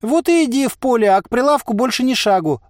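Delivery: loud, high-pitched merchant's yell; agitated high cry. A narrator's reading. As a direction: shout them away from your stall. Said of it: "завопил Харчик".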